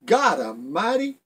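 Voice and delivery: a hammed-up jump-awake exclamation; startled yell. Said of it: "[name] jumped and yelled".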